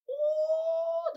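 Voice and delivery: a delighted high yelp; excited ooh. Real, not acted. Hyping the gang leader to viewers, high excited ooh.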